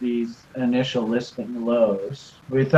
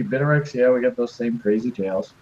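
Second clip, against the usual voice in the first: ordinary talk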